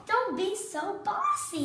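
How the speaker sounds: high, loud, drawn-out scolding; scolding vowel character. Acted. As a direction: play a fed-up letter telling off its bossy neighbour. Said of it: "in the other A is saying".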